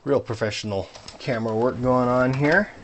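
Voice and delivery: mock-impressed sarcasm, rising at the end; sarcastic. Real, not acted. Vlogger mocking his own camera work, sarcastic. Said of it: "That was my sarcasm voice".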